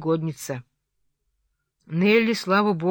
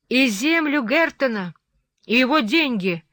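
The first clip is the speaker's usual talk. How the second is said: high, raised taunt; defiant retort; 5 semitones higher, 4 dB louder than usual. A narrator's reading. Catherine defiantly taunting Heathcliff about Hareton's land. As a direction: throw it back at him boldly. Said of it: "продолжала безрассудная упрямица".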